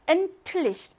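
엔틀리시 with a voiceless "t" before "lich"; hardened t. Shown as a demonstration. Teacher demonstrates devoicing, 엔틀리시 with a hard t. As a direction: make the d a crisp t in 엔틀리시. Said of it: "트 소리가 나고요".

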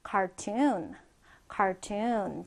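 ordinary talk

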